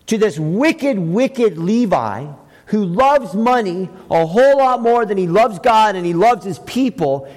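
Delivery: ordinary talk, nothing marked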